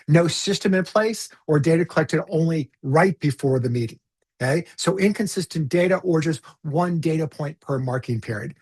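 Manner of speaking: ordinary delivery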